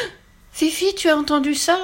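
gasp, then very high, alarmed question; scared little girl turning to her friend; frightened voice. A narrator's reading. Little girl hears the tree will fall, frightened. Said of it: "demanda-t-elle d'une voix effrayée".

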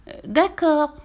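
high, reluctant piglet sigh. A narrator's reading. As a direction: give in with a small high sigh. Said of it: "soupira le porcelet". (soupir résigné de porcelet)

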